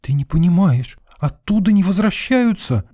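high, urgent, scared cry; alarmed high-pitched warning; frightened exclamation. A narrator's reading. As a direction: exclaim it in fear, pitch shooting up. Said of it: "негромко воскликнула Лена".

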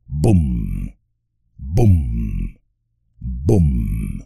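low, booming voiced drumbeat; deep drum boom. A narrator's reading. Narrator booming out the drums of Moria, deep and distant. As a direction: boom it out like a far-off war drum. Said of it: "redoblaban los tambores en los abismos".

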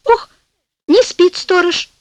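plain reading voice

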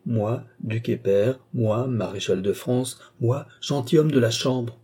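slow, weighty listing of each title; the duke mockingly repeats his titles back to the baron, slowly; slow bitter echo. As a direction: drag out every title with rueful pride.

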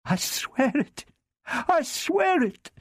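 high, rising frantic plea; desperate pleading. A narrator's reading. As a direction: swear it, growing desperate.

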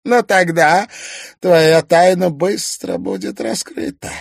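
high, loud gloating villain; theatrical villain. Acted. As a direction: relish every cruel word. Toymaster continues.

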